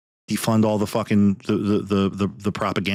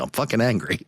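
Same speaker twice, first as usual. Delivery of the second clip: ordinary talk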